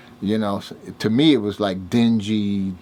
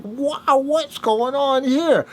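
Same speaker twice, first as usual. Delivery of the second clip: high, drawn-out wonder; amazed reaction; much slower than usual. Retold or imitated. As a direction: act out being amazed, voice way up and slowing. Acts out amazement at the party.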